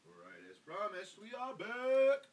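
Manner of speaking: grand slow we are back; hammed-up host return. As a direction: boom it out like a show host coming back on air. Announcing return from break.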